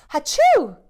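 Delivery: big loud hachoo; a loud fake sneeze. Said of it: "Who sneezed?".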